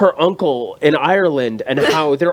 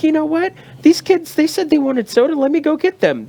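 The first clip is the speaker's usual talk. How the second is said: chirpy lady; 9 semitones higher than usual. Retold or imitated. Voicing the closing lady.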